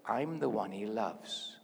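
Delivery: slow, low model line for others to repeat; deliberate declaration. Shown as a demonstration. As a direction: say it slowly as a personal affirmation. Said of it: "I'm gonna say it".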